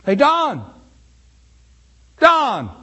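loud, high call across a room. Pretend. Preacher calling a man who cannot hear, loud high call. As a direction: shout a name to get attention.

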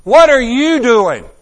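high, loud playful call; Jesus, loud and curious. Retold or imitated. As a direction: call out to get his attention. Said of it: "and He goes".

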